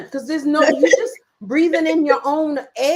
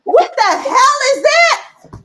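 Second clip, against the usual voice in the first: much higher, loud and slow disbelief; incredulous outburst; 13 semitones higher, 7 dB louder, slower than usual. Retold or imitated. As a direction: blurt it out like it's absurd. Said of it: "under the covers with the lights out".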